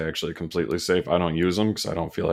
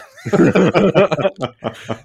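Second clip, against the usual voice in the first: loud high laugh; 12 semitones higher, 9 dB louder than usual. Real, not acted. Reacting to the uncle story, a loud high laugh.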